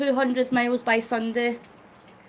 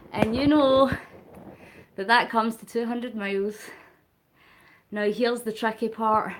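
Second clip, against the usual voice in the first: ordinary talk, nothing marked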